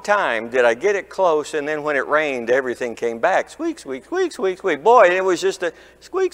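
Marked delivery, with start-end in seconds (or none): 3.5-4.8 squeaky car noise. Retold or imitated. Pastor mimicking the squeaks coming back after rain, high squeaky voice.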